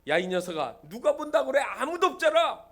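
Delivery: scolding, rising to a high shout; angry father. Acted. Father scolds son for false alarm, angry father. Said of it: "화가 난 아버지가".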